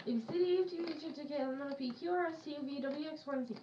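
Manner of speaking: very fast letter rattle; fast alphabet. Shown as a demonstration. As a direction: blitz through every letter. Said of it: "You cheated. You forgot P".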